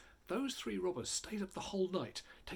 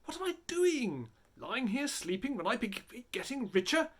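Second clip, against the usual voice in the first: much higher, fretful; first robber waking up, higher and fretful; anxious greedy thought; 7 semitones higher than usual. A narrator's reading. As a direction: fret that others are gaining. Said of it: "thought to himself".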